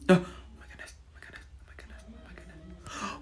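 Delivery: rapid, unvoiced whisper. Real, not acted. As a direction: whisper it over and over, barely audible. Nervous repeating while playing.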